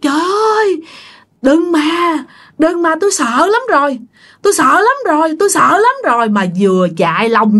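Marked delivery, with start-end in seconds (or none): 0.0-6.3 high-pitched mock panicked pleading; mild retold scream. Terrified woman running around the neighbourhood, mild retold scream. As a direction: imitate a frightened cry without really shouting. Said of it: "Vừa chạy mà vừa hét".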